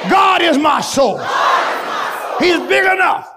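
ordinary talk, nothing marked

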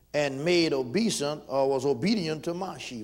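preaching. Acted. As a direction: proclaim Joseph's dream to the church. Preaching scripture.

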